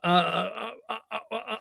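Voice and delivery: high, quiet stuttered "uh"s; flustered stammering. Acted. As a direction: stammer helplessly, lost for words. Acts out Ben lost for words.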